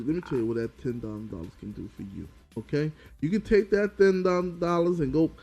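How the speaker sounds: ordinary talk, nothing marked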